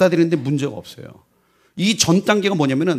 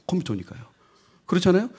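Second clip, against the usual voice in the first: usual speaking voice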